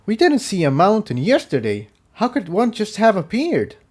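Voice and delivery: a pony who can't believe his eyes; stunned disbelief. A narrator's reading. Jigsaw stunned by the mountain.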